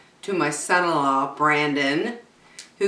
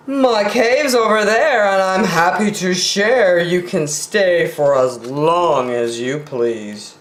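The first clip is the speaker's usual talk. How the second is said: Brown Bear voice; 2 semitones higher than usual. Acted. Bear offers his cave.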